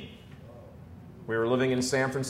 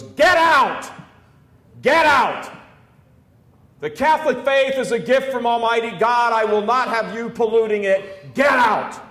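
much higher, much louder furious yell; furious shout; 11 semitones higher, 8 dB louder than usual. Retold or imitated. Old bishop ordering the hippie out of the sacristy, furious shout. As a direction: throw him out with a roar. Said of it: "yelled at the guy".

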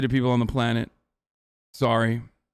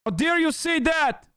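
much higher, slow mock outrage; outraged viewer parody, pitched way up; mock outraged squeal; 13 semitones higher, slower than usual. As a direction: squeak it out in fake indignation. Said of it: "community members in here that were like".

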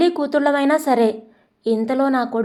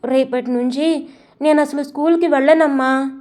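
ordinary delivery

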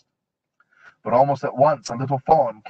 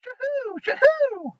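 very high hooting owl voice; high sleepy owl hoot; slower than usual. A narrator's reading. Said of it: "said a sleepy voice somewhere above her".

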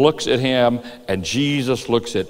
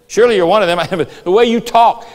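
raised, louder mock accusation; accusing; 6 semitones higher, 5 dB louder than usual. Bystanders pointing Peter out, accusing. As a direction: call him out, pitched up.

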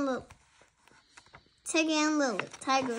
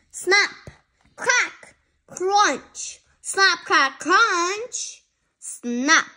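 loud crunching sounds; 5 semitones higher, 5 dB louder, much slower than usual. Acted. Tiger chomping into its new home, loud crunchy sounds. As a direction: snap and crunch sounds called out high and loud.

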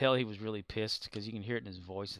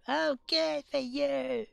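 put-on changed child voice; 13 semitones higher, 4 dB louder than usual. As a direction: speak like a small child actor. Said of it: "change the voice and go".

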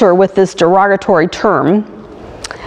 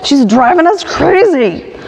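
higher-pitched, exasperated whine played for laughs; mock complaining; 5 semitones higher than usual. Disciples grumble to Jesus about the woman, mock complaining. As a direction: complain like you are fed up with her. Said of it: "come to Jesus and complain and say".

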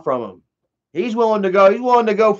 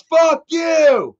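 attacking yell; 10 semitones higher than usual. Retold or imitated. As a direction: scream it while charging in. Isaac attacking the neighbor.

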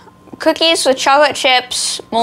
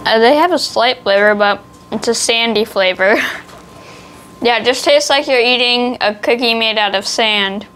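speaking between nibbles; talking while chewing. Real, not acted. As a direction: mouth partly full of cookie. Seen 1: hand holds cookie at mouth, nibbling and chewing while talking.

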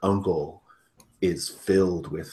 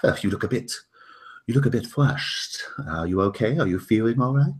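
fussy host voice; 6 semitones higher, 6 dB louder than usual. Acted. Host fusses over flushed guest.

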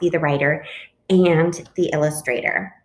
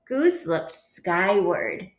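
plain reading voice

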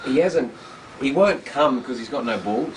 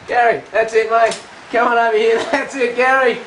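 calling out; 8 semitones higher, 7 dB louder than usual. Real, not acted. Coaxing the goat to come.

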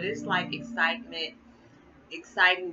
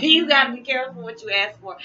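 laughing; 7 semitones higher, 11 dB louder than usual. Real, not acted.

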